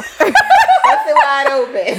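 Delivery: laughing. Real, not acted. Laughing bawdy joke.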